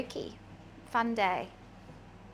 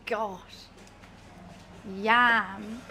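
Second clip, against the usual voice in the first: disgusted; 2 semitones lower than usual. Pretend.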